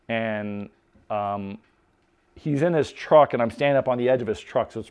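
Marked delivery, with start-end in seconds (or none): none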